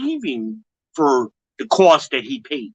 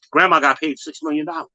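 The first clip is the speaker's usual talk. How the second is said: usual speaking voice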